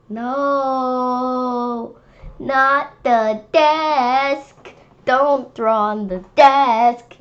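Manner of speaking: loud, drawn-out scolding; slow and loud, telling him off; scolding teacher. Acted. Kid as teacher, scolding David loudly for drawing on the desk.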